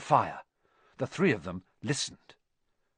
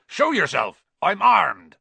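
loud, high threatening shout; calling out a forceful challenge; called out; 8 semitones higher, 9 dB louder than usual. A narrator's reading. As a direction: shout a warning to an unseen intruder. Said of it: "Hagrid called".

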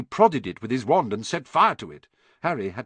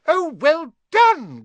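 very high, loud, squeaky cry of delight; 9 dB louder, much slower than usual. A narrator's reading. Flitwick praising Hermione's spell, high excited squeak. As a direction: squeal with joy. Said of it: "cried Professor Flitwick".

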